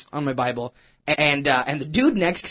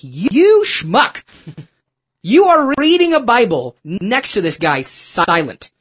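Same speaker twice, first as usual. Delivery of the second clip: loud, higher self-rebuke; self-scolding; 5 semitones higher, 6 dB louder, slower than usual. Retold or imitated. Scolding himself for sitting silent beside the man, loud. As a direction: tear into yourself for being a coward.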